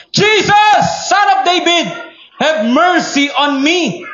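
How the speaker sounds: loud, high, desperate cry for mercy; shouting Bartimaeus. Acted. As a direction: cry out to Jesus from afar. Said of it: "he began to shout". (sumisigaw na nagmamakaawa kay Hesus)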